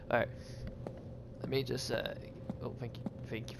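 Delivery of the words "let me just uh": normal talking voice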